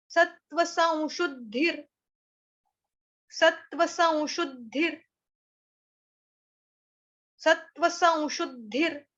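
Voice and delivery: सत्व संशुद्धिर repeated slowly with a rise on सत्व; slow drill. Shown as a demonstration. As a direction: drill the phrase slowly, pressing द्ध. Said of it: "आ गया आपका यहाँ पे शुद्धि". (धीमे दोहराकर अभ्यास)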